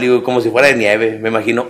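normal talking voice

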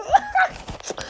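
very high squealing giggle. Retold or imitated. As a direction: giggle like a girl. Laughs in character.

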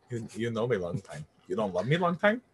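ribbing a buddy with the movie line; mock movie-quote accent. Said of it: "the Full Metal Jacket movie".

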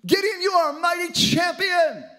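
booming God voice. Acted. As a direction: proclaim like God rallying a hero. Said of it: "God comes to him and says".

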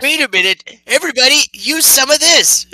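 high, loud character voice; rally everyone in a high, forceful voice; high-pitched bossy call to the group; put-on character calling out. Calls out in character to party.

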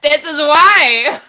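laughing emphasis. Real, not acted. Punchline after coworker quote.

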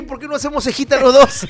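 high and loud, eager invitation; excited proposal. Retold or imitated. To the guy in line, giddy high invite. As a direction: pitch it up like a giddy dare. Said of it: "lo vi y dije".

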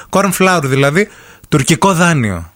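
ordinary delivery

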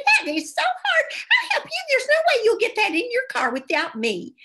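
sugary high "oh honey" lady voice; sweet helpful lady. Retold or imitated. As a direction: coo it up high like a doting stranger. Sweet stranger offering help, voiced.

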